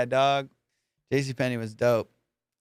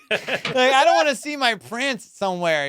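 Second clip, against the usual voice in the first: outraged; 8 semitones higher, 4 dB louder than usual. Real, not acted. Outraged about royals in public.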